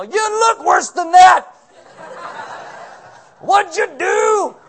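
high, loud, slow drill sergeant shout. Retold or imitated. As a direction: bark at the recruits like a drill sergeant. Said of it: "drill sergeant".

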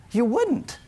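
high, rising disbelieving answer; as if the idea is absurd; incredulous no. Acted. Preacher answering his own question, incredulous.

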